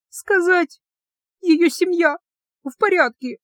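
high, tearful broken request. A narrator's reading. Heroine crying, asking to tell Grir's family. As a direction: ask through tears.

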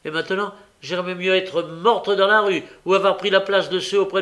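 high, loud, indignant outburst; angry tirade. A narrator's reading. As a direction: pour out the accusation at full heat. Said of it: "avec colère".

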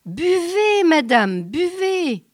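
loud booming giant urging; dim slow booming giant. A narrator's reading. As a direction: push a drink on her, booming. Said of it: "dit le géant". (géant tonitruant qui insiste)

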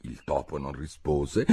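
plain reading voice

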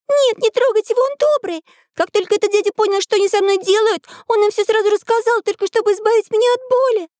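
put-on very high, urgent child's voice; little girl crying out. A narrator's reading. Girl defending the prisoner from the soldiers, high pleading cry. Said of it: "Девочка Мариам закричала".

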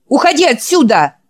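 loud, high order to get out; angry shout. Acted. Character chasing a man away, loud high shout. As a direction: bark him out of the room.

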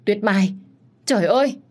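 high, loud cry of alarm. A narrator's reading. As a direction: cry out her name in alarm. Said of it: "Anh Tuấn hét lớn". (hét lớn hốt hoảng)

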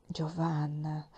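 "Giovanna" said softly and slowly, dropping low; soft calling. Acted. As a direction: call her name gently and slowly. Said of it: "mi sussurrò il vescovo". ("Giovanna" detto piano, lento e basso)

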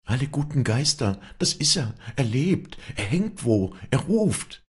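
high, breathless-sounding excitement; thrilled realisation. A narrator's reading. Walli realises Josef is alive below, high and excited. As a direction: exclaim with rising, astonished urgency.

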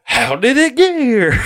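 high, strained sergeant impression; movie drill sergeant. Quoting Full Metal Jacket drill sergeant.

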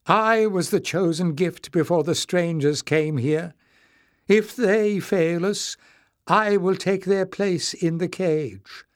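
high, proud declaration. A narrator's reading. Knowles offering to take their place, high and proud. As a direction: declare it with pride. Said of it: "looked at him proudly".

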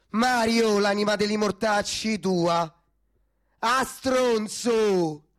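furious calling out across the street; angry shout. Acted. Yelling insults after a fleeing man. (urla rabbiose dietro a uno che scappa)

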